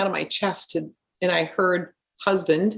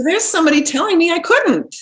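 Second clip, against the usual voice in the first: high, loud mock indignation after a laugh; amused indignation; 11 semitones higher, 7 dB louder than usual. Real, not acted. Student recalling a teacher's doubt, amused indignation. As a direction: raise the voice in incredulous protest.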